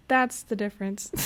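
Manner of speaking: ordinary talk, nothing marked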